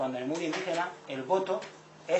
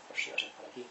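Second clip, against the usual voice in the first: hushed private decision; 3 semitones lower, 7 dB quieter than usual. Retold or imitated. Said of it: "y dices".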